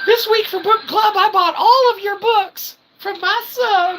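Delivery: high put-on mother voice announcing the book club; mock mom voice. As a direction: crow it out like a proud mother.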